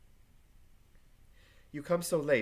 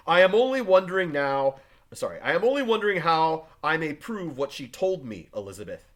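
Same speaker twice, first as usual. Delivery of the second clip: loud, raised and insistent; heated defence; 3 semitones higher, 9 dB louder than usual. Acted. Husband justifying himself to wife, heated and loud. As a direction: push back hard, still fuming.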